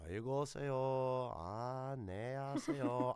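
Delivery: put-on very slow, drawn-out old Korean sigh; mock Korean elder. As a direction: drag it out like a tired Korean grandma.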